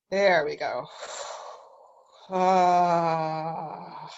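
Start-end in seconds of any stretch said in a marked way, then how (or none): 0.9-1.5 soft, voiceless puff of breath; deep exhalation. Shown as a demonstration. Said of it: "deep exhalation". 2.3-4.1 drawn-out "ahh" on the exhale; audible sigh. Shown as a demonstration. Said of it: "audible sound".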